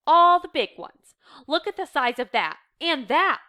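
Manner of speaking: loud, high, emphatic complaint; indignant accusation. A narrator's reading. Reader voicing a housemate accusing the divider, indignant. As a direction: hammer home each unfair share. Acted accusation over shares.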